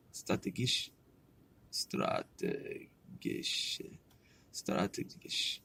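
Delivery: slow, hushed syllable-by-syllable tries, fading to a whisper; practising German pronunciation. Real, not acted. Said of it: "try to pronounce this shit now".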